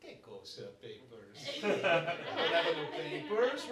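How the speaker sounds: playing a lost Italian immigrant, Italian accent. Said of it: "And we said".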